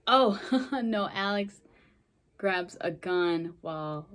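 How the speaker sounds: ordinary talk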